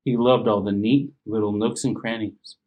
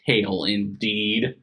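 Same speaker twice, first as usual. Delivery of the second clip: plain reading voice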